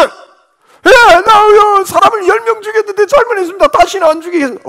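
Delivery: high, fast, loud mock confession; murderer's breezy plea. Killer casually begging the judge, high and fast. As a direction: rattle off the apology carelessly. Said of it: "너는".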